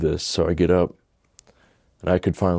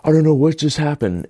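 high-pitched, elated disbelief, acted out; amazed retelling; 8 semitones higher than usual. Telling his proud friend he's back, acted-out amazement. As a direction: burst out high, astonished to be back. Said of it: "And I was like".

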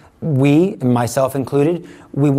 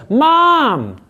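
high call of Mom; child calling; 11 semitones higher, 4 dB louder than usual. Retold or imitated. Child yelling for mom, high and urgent. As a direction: call out like a child wanting help.

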